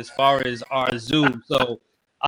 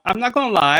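ordinary delivery

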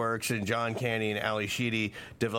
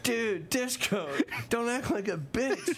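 much higher, teasing wife scolding her husband; mock wife voice; 12 semitones higher than usual. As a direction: play the scolding wife, pitched way up.

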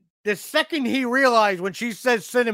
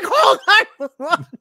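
high yell broken by laughter; retelling the father's panicked cry, laughing; laughing mock panic; 8 semitones higher, 7 dB louder than usual. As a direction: shout it while cracking up. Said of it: "He's like".

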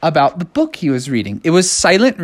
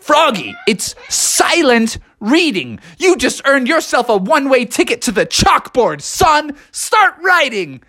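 stern teacher; 8 semitones higher than usual. Retold or imitated. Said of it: "the teacher was like".

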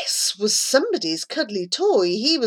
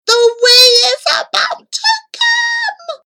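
panicked mouthing; 7 dB louder than usual. A narrator's reading. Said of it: "Charlie mouthed, panic on his face".

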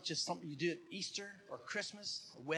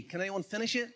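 ordinary talk

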